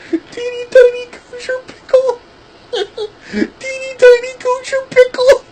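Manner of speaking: squeaky voice breaking into laughter; high laughing squeal. Retold or imitated. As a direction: squeal the pickle line through giggles. Mocking quote, laughing.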